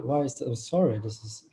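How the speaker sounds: usual speaking voice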